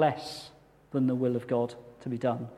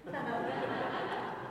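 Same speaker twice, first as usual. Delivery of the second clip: self-mocking laugh; 11 semitones higher, 10 dB quieter than usual. Real, not acted. Laughs at his own joke.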